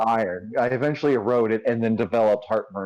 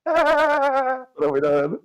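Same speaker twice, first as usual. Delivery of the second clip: mock dramatic lament; 13 semitones higher, much slower than usual.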